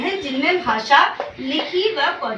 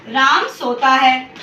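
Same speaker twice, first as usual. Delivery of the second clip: says राम सोता है slowly and clearly; slow example sentence; 2 semitones lower, 4 dB louder, slower than usual. Shown as a demonstration. Teacher reading the correct example to the class slowly. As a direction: read out slowly as a model sentence. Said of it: "अगर आपको बोलूँ".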